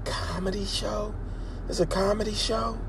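high, soft curious whisper; whispering passengers. Retold or imitated. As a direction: hush it like intrigued strangers. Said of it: "were whispering".

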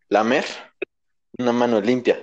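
normal talking voice

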